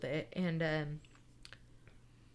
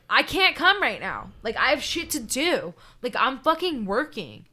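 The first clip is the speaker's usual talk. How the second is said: higher, louder impatient snap; irritated brush-off; 5 semitones higher, 8 dB louder than usual. Retold or imitated. To his sick girlfriend on the phone, annoyed and loud. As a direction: brush her off, annoyed and busy. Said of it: "what I fucking said to her in this voice".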